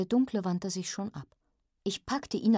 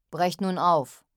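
command; 7 dB louder, slower than usual. A narrator's reading. Leader orders his men off.

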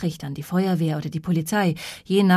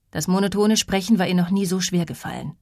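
usual speaking voice

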